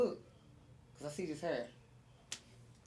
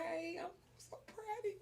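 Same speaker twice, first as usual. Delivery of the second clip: touched reply; 8 semitones higher, 18 dB quieter than usual. Retold or imitated.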